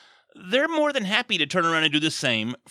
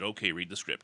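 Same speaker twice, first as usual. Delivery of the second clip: much lower and softer, almost under the breath; quiet quoted instruction; 10 semitones lower, 11 dB quieter than usual. Retold or imitated. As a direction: murmur the order, low and soft. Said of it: "and he said".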